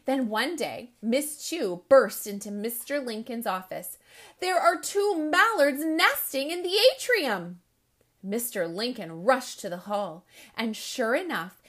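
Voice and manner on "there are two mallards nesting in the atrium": high, loud and breathless announcement; excited news. Acted. Teacher bursting into the principal's office, excited and loud.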